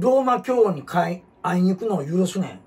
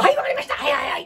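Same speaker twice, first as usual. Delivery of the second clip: eager yes-man; 7 semitones higher, faster than usual. Retold or imitated. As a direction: snap to attention like a flatterer. Masamune fawning over Ieyasu. (家康にへつらう伊達政宗、甲高く大声で「はい、はい」)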